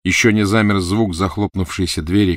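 plain reading voice

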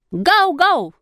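high-pitched, playful human bark; loud imitation barking. A narrator's reading. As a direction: bark like a dog, high and lively. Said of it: "ответил я громко". (громко лает, подражая собаке)

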